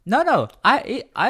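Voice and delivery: ordinary talk, nothing marked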